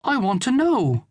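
put-on very high child's voice; insistent boy. A narrator's reading. Son pressing for an answer, insistent. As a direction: insist like a young lad, high-pitched.

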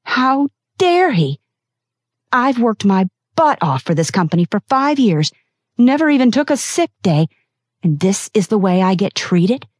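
furious and disbelieving, building; outraged employee venting; rising anger. A narrator's reading. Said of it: "anger set in".

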